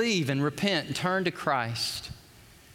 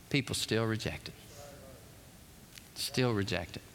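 quiet repeat; 6 semitones lower, 8 dB quieter than usual. Acted. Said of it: "repeated for emphasis".

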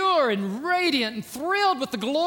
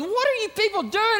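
high, exasperated outburst; imitating furious Moses scolding the people; mock outrage at the golden calf; angry Moses; 7 semitones higher than usual. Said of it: "He was so angry with them".

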